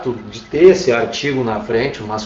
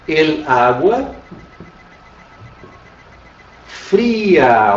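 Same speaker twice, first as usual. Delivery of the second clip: slow example; much slower than usual. Shown as a demonstration. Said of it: "por exemplo".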